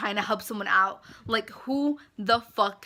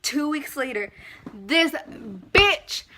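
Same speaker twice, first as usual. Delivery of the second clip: angry rant; 3 semitones higher, 8 dB louder than usual. Real, not acted. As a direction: let the outrage burst out. Ranting about the scammer.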